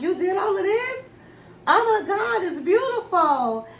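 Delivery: high, laughing gush of delight; retold wife gushing with a laugh over the romantic room; delighted surprise. Wife sees decorated hotel room.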